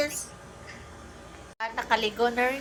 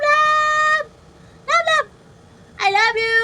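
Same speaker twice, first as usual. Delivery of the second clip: high loving coo; 7 semitones higher, 6 dB louder than usual. Real, not acted. Cooing to pet.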